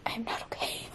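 unvoiced teary whisper. Real, not acted. Answering her dogs in a teary whisper. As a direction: whisper it, defeated.

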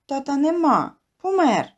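ordinary delivery

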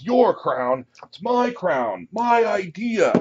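high, drawn-out indignant monster voice; slow, high-pitched bickering yell; squabbling monster head. Acted. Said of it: "they begin yelling at one another".

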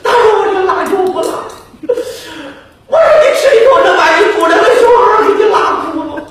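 high, laughing indignant complaint; huffy retelling of the fight; mock quarrelsome vendor. Vegetable seller complaining to nuns about the old lady, high and indignant.

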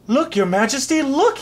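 high, rising cry of joy; excited shout. A narrator's reading. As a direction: shout for the king to see. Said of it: "he shouted to the king".

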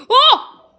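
sudden high, loud yelp; short burst of shock; startled yelp. Real, not acted. Player startled mid chase, high loud yelp.

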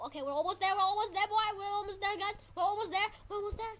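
high, rapidly repeated cheer; excited urging. Real, not acted. Player nearly at the island, excited urging. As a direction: chant it eagerly as the goal nears.

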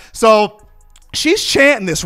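usual speaking voice

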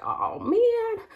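sudden high-pitched oh man; dismayed exclamation. Retold or imitated. Retelling losing her last contact lens, high dismayed yelp. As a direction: squeak it out in mock dismay. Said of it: "And I was just like".

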